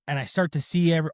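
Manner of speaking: ordinary delivery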